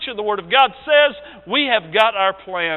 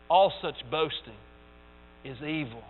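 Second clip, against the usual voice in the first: ordinary talk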